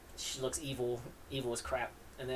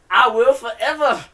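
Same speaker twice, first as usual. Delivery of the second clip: loud declaration; 8 semitones higher, 12 dB louder than usual. Acted. Dude declares love in comic.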